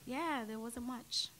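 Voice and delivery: softly spoken English accent. Shown as a demonstration. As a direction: answer in an English accent, unhurried. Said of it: "Your English accent?".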